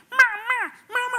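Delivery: very high, loud imitated yell; yelling mama. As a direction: belt out mama high and loud, calling to mom. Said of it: "it's getting louder and louder".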